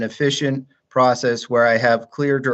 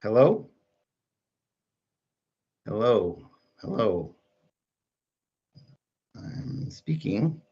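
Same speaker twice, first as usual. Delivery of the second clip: faint, cutting-out line; call dropping out; 5 dB quieter than usual. Real, not acted. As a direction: voice fading on a bad connection. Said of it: "He does not".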